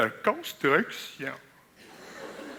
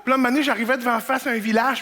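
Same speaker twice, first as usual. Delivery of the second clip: normal talking voice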